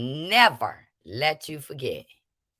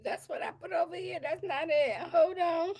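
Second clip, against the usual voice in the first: muttering to self; 11 semitones higher, 7 dB quieter than usual. Real, not acted. Searching for clip, muttering.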